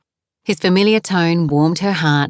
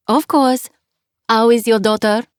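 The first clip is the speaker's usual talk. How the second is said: put-on French accent; 5 semitones higher than usual. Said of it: "her accent was heavy".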